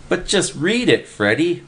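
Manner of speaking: high, eager pleading voice; shrieking Willie. A narrator's reading. A boy shrieking at Freddy to read the paper, high and eager. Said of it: "Willie shrieked".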